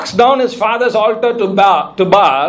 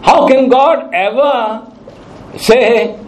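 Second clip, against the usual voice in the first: slow doubting thought; much slower than usual. Acted. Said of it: "you're thinking".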